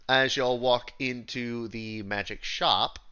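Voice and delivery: normal talking voice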